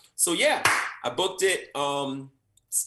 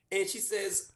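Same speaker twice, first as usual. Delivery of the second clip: ordinary talk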